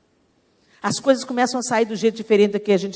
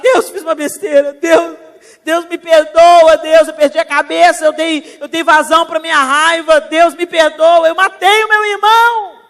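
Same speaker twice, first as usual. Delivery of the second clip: louder, higher, desperate begging for forgiveness; pleading confession; 7 semitones higher, 10 dB louder than usual. Acted. Cain confessing to God, louder and higher, begging pardon. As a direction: cry out to God in remorse. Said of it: "Caim podia ter falado".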